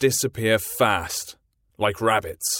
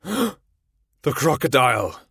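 frightened cry; 5 semitones higher, 3 dB louder, slower than usual. A narrator's reading. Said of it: "he cried".

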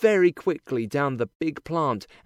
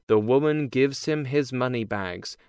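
ordinary delivery

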